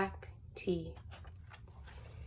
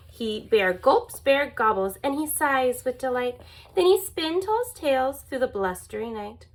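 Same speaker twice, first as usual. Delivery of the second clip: usual speaking voice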